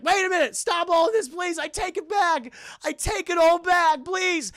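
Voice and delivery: high, loud mock plea; hammed-up desperate pleading; frantic begging. Max begs Krampus to stop.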